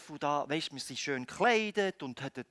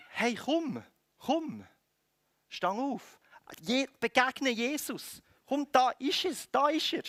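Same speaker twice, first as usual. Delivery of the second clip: higher-pitched, inviting urging; encouraging; 6 semitones higher than usual. Acted. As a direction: coax someone over to meet a person. Changed crowd urging him toward Jesus.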